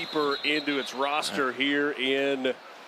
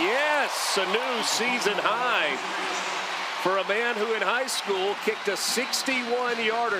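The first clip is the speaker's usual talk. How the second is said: excited play-by-play; 4 semitones higher than usual. Real, not acted. Announcer calling a long field goal, excited play-by-play. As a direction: draw out the question, then jump up in triumph.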